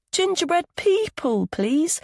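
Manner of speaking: put-on little boy. A narrator's reading. Little Milo asks his mum for gingerbread people. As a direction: voice a small eager boy.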